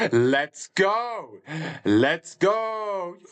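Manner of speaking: high, loud victory cheer; triumphant cheer. Real, not acted. Player celebrates getting Luxembourgish right, triumphant cheer. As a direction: pump it up like a win.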